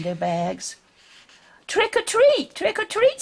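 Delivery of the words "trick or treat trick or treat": high, eager trick or treat; eager girl calling. Acted. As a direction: call it out at the door, much higher.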